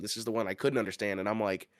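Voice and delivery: usual speaking voice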